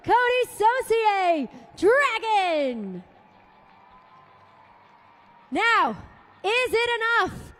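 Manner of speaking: big, high, loud stadium announcer voice; arena announcer. Acted. Announcer crowning the overall champion to the Fargo crowd, booming. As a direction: sell the champion reveal to the whole arena.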